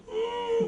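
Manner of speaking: high, soft overacted whine; hammed-up sad little moan; mock sad whimper. Shown as a demonstration. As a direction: fake being sad with a squeal. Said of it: "If you're sad".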